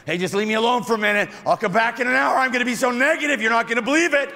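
hammed-up excitement about being negative; mock gleeful negativity. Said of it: "Nobody's like".

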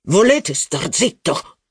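acted exasperated yell, moderate volume; mock yell. A narrator's reading. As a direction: stretch it out as an irate command, not truly shouted. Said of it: "urlò il duca".

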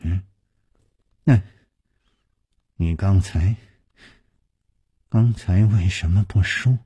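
halting, low question; trembling voice. A narrator's reading. Youth asking his rescuer about his silence, voice trembling. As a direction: falter with long gaps between phrases. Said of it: "颤声说".

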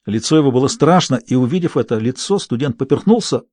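plain reading voice

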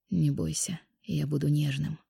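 soft, low tender promise; tender reassurance. A narrator's reading. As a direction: reassure her gently and softly. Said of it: "пообещал он".

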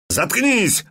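a sharp, high snap of fury; flaring up. Acted. Thug snapping at the witcher, high and furious. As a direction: flare up and shut him down. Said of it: "взвился Лысый".

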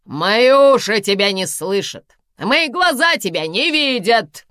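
loud, high, slow defiant yell; shouting back. A narrator's reading. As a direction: hurl it back to shut her out. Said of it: "крикнула в ответ Карина". (громкий высокий ответный крик)

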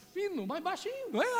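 high thin voice showing the horn's sound; thin and soft. Shown as a demonstration. Preacher showing the congregation the ram's horn sound, high and thin. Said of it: "Ela é um sonido assim".

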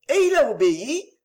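elle n'en revient pas, voix qui monte; étonnement. A narrator's reading. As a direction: question plus aiguë, plus forte, stupéfaite. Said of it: "au comble de l'étonnement". (Marguerite, stupéfaite, questionne son mari)

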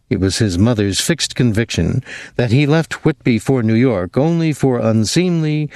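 ordinary delivery

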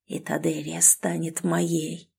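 soft, slow hushed voice; greedy whisper. Acted. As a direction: savour it under the breath. Drim whispering his goal. (жадный шёпот)

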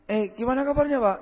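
lightly voiced mother asking after him; lady's greeting. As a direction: imitate a neighbourhood mother calling out hello. Voicing a lady greeting him.

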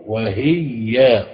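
وهي very slow, "wa-hiyy-ya" with a held y; doubled ya in وهي. Shown as a demonstration. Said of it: "في تشديد يائه".

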